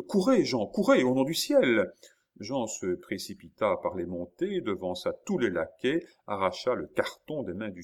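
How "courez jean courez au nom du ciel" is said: high, loud pleading order; urgent command. A narrator's reading. Countess sending Jean after the box, urgently. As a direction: send him off in desperate haste.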